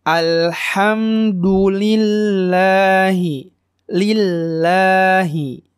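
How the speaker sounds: Alhamdulillahi chanted slowly, m and double l held; slow measured chant, lingering on lam in Lillahi; model recitation. Shown as a demonstration. Teacher models verse for learners, slow held lam. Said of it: "Nah, seperti itu ya".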